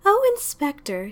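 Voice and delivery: a lightly voiced landlady's glad cry; pleased surprise at the door; landlady greeting the inspector, exclaiming. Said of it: "she exclaimed".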